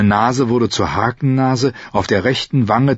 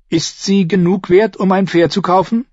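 put-on high-pitched girl's question; eager young girl; 11 semitones higher, 4 dB louder than usual. A narrator's reading. Girl asking the coin's worth, high and eager. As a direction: ask in a high, girlish voice. Girl Arya asks about coin.

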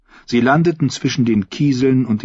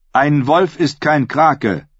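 slow, weighty objection; contradicting; 7 semitones higher, 3 dB louder, slower than usual. A narrator's reading. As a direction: counter it slowly and firmly. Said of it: "widersprach Victarion".

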